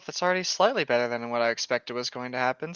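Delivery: normal talking voice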